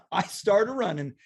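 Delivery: ordinary talk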